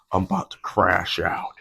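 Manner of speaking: low, altered voice playing at losing it; deadpan joke threat; mock meltdown. Spilling coffee, joking to the camera, low mock menace. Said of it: "I'm just kidding".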